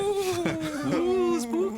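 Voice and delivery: high wailing ghost ooh; spooky ghost voice. Acted. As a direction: wail it like a ghost, laughing.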